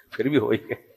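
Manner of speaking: hushed repeat. Real, not acted. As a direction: chuckle it again quietly to yourself. Soft repeat after a laugh.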